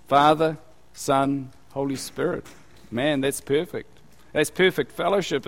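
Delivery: ordinary delivery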